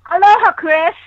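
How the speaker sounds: ordinary talk, nothing marked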